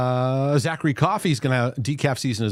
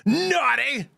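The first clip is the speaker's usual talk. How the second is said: high yelled Southern elf verdict; mock Southern yell; 14 semitones higher than usual. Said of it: "he's like".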